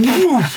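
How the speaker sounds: imitated blast of force; a high, sudden "woof"; the whoosh of being hit; whooshing impact. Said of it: "like that".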